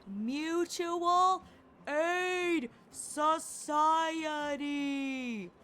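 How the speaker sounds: each word shouted out slowly and loudly. Pretend. To the deaf old lady, repeating it in a slow, loud shout. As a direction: spell it out loud for a hard-of-hearing listener. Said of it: "Do you have to shout?".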